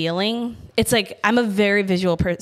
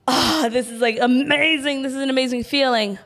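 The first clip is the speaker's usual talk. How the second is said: gasp, then a higher, savoring rush; elated high; 4 semitones higher than usual. Acted. Speaker voices feeling a high, awed and elated. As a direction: breathe in a wave of wonder. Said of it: "you're feeling a high".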